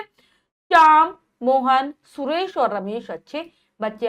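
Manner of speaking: brief breaks after Shyam and Mohan; slow list, Shyam held and rising, then Mohan apart; short comma pauses. Shown as a demonstration. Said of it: "कितना कम कम समय के लिए रुक रहे हैं".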